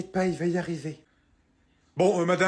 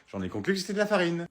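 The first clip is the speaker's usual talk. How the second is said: ordinary talk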